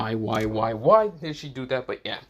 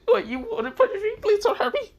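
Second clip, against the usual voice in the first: very high squeaky voice; much higher, squeaky comic pleading; squeaky mock fear. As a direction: squeal like a scared little kid. Sanji answers Seraphim's punishment remark.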